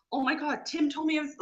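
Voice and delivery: high, excited gushing. Retold or imitated. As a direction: gush it like thrilled houseguests. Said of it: "they freak out".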